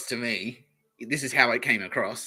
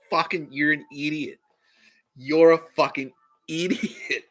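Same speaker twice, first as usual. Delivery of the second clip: mock shouting; 4 semitones higher, 3 dB louder, slower than usual. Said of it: "I shouted".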